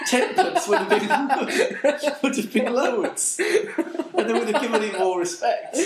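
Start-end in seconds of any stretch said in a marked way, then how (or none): 0.1-0.7 TEN PENCE as "TEN-punce", through laughter; high, loud, laughing mock "tenpunce"; old-fashioned pronunciation. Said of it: "when people were saying pence".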